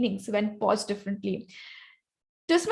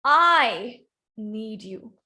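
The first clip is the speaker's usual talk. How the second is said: slow, emphatic I; stress on I; 3 semitones lower, much slower than usual. Shown as a demonstration. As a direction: lean hard on I and let the rest drop. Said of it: "I'm gonna stress or key the word I".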